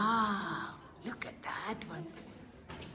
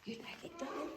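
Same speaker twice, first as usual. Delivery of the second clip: softly ask, hushed; whispering; 5 semitones higher, 7 dB quieter, faster than usual. Real, not acted. Asking child quietly in cave.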